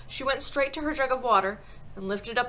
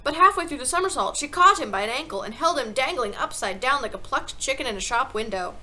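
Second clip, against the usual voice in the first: ordinary delivery, nothing marked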